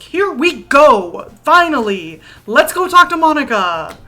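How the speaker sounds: loud, drawn-out triumphant shout; real, loud burst of eagerness; excited cheer. Real, not acted. Streamer cheers reaching Monica scene.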